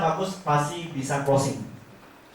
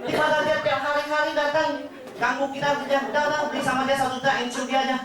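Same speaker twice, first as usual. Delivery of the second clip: much higher, scornful voice; mocking husband; 8 semitones higher than usual. Husband mocks the agent as a beggar, high scornful voice. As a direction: sneer at the pushy agent from up high. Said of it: "suaminya ngomong apa".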